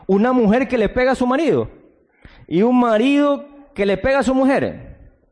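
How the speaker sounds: fiery sermon denouncing violence between Christians; preaching at full force. Acted. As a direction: thunder at the congregation over the beatings. Preacher rebuking the congregation.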